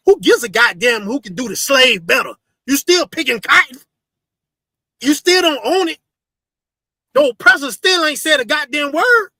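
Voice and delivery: loud, high fiery preacher shouting; fiery preaching. Acted. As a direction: preach it at full force, raising your voice. Preaching at full force.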